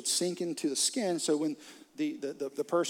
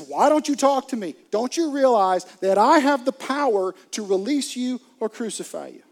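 loud, higher, demanding shout; frustrated Pilate; 7 semitones higher, 10 dB louder than usual. Retold or imitated. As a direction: bark it at a silent prisoner, fed up. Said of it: "Pilate gets a little frustrated".

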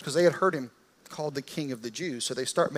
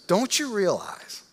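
higher, slower, pressing hard; frustrated demand; 6 semitones higher, 3 dB louder, slower than usual. Retold or imitated. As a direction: lean in and demand an answer. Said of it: "Pilate kinda loses his temper".

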